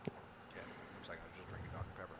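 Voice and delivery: whispering. Real, not acted. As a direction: murmur it off mic. Just drank soda.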